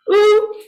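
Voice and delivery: high, loud, rounded French "Ooh"; French U sound. Shown as a demonstration. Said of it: "sounds like the French oo".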